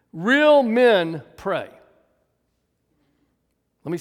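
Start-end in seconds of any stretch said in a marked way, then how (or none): 0.1-1.7 REAL and MEN drawn out loud, each word set apart; slow, loud emphatic slogan. Real, not acted. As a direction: declare it slowly and forcefully, leaning on REAL. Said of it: "Third time for emphasis".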